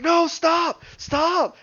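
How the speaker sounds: high, loud cries to stop; mock frantic plea. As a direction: act out a player yelling in protest. Player begging another to stop.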